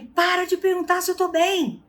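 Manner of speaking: irritated snap. Retold or imitated. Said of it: "Aí ele".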